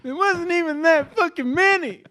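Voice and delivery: high, loud complaining mother; mock mom. Mocking the mom about dishes, high and loud. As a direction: gripe like a fed-up mama.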